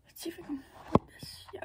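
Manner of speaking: quick hushed whisper. Real, not acted. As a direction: whisper it as an aside. Whispered asides while filming.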